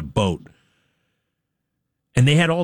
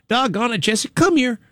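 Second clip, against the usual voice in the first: higher, easy, harmless-sounding dog call; calm, innocent; 10 semitones higher than usual. Retold or imitated. The retold Snapchat clip, father calling his dog innocently. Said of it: "It was just like that".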